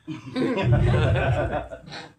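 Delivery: a burst of real laughter; loud laugh. Real, not acted. Guest laughing at his own joke about himself. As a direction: let the laugh break out. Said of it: "Why are you laughing?".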